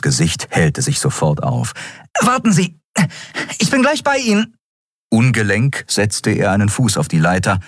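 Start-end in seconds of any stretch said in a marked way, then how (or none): 2.2-4.6 put-on very high, bright boyish voice; a delighted boy calling out; young Miko. A narrator's reading. Boy Miko answers captain. (sehr hohe Jungenstimme, erfreut)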